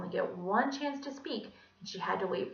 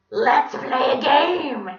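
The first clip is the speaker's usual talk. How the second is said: loud, slow, higher-pitched villain bray; creepy French donkey; 6 semitones higher, 14 dB louder, much slower than usual. A narrator's reading. Villain proposing a game to trapped children, creepy. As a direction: bray it slowly with a French accent. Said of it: "his normal creepy French donkey voice".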